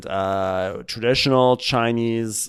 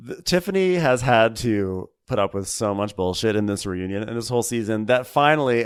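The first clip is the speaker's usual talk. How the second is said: usual speaking voice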